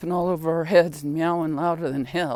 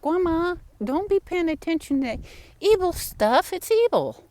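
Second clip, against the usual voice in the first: put-on high, little-girl voice scolding grandma; granddaughters' voice; 13 semitones higher than usual. Young granddaughter scolding grandma in a high voice. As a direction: pitch way up like a young girl warning. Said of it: "my girls would say".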